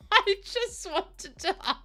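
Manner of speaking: high teary laugh, slow; laugh-crying. Real, not acted. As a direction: laugh through the tears. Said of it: "I'm crying".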